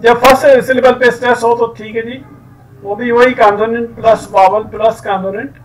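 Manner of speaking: ordinary delivery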